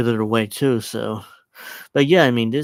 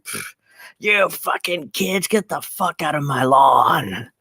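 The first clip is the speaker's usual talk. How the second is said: put-on high, slow grumpy old lady; old person yelling at kids; 4 semitones higher, slower than usual. Mocking the senator as a cranky old lady yelling at kids. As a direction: sneer it like a cranky old woman shooing kids. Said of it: "the old person yells at kids".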